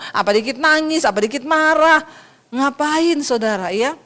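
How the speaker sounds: normal talking voice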